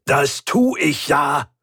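understated roar, stretched slowly; roared retort. A narrator's reading. Mechanic told to think, roaring back. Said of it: "brüllte er".

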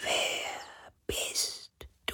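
very slow, barely audible wind whisper; faintest whisper. A narrator's reading. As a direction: whisper it like a dying breath of wind. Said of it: "so leise wie der Schatten des Hauchs eines Flüsterns". (hauchzartes, langsames Flüstern)

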